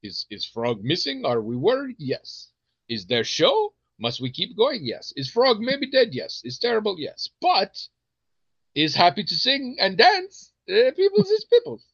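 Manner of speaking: hammed-up inspirational speech in broken English; mock-comforting speech, building to a high finish; diner owner's nonsense pep talk. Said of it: "he just keeps giving these inspirational and comforting speeches".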